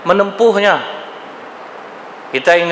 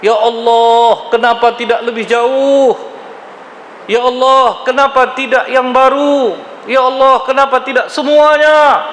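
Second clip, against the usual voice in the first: each cry more urgent than the last; rising cries; 8 semitones higher than usual. Retold or imitated. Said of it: "menjerit-jerit dia, memekik dia tiga kali".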